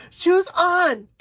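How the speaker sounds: put-on high, loud toddler demand; mock tantrum shout; imitating mad toddler. Imitating her grandson demanding his shoes, high mock tantrum shout. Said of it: "he gets so mad".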